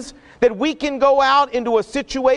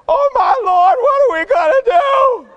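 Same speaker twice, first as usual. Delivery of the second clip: high, loud panicked shout; the frightened servant crying out in alarm; 12 semitones higher, 5 dB louder than usual. Acted. Servant runs to Elisha, terrified by the army: panicked high shout. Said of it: "How do you know he said it like that?".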